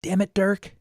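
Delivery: high, loud exasperated call; mock yell. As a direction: yell it across the room, fed up. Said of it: "they would just yell across the room".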